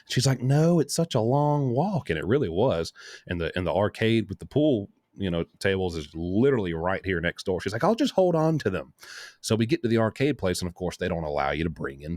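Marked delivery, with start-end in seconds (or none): 0.4-2.1 higher, drawn-out girlfriend imitation; mock whiny protest. Girlfriend refusing the walk to the car, whiny mimicry. 7.8-8.9 higher-pitched mimicked voice; imitated girlfriend. Girlfriend keeping her box of fries, higher imitation.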